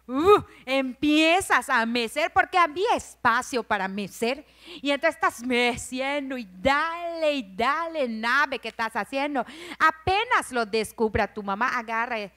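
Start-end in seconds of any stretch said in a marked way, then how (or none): none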